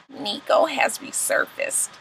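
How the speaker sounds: soft, slow, half-whispered confession; hushed confession. Retold or imitated. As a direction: let the guilty news slip out quietly. Confession voiced for Mimi.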